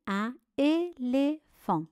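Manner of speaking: "éléphant" split into three separate syllables. Shown as a demonstration. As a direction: dictate "éléphant" one syllable at a time. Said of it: "Voici les syllabes". (« éléphant » découpé syllabe par syllabe)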